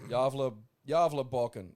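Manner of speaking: "Javle, Javleboken" said loud, J sound, carefully. Shown as a demonstration. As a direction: repeat "Javleboken" firmly. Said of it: "pronounced Javle".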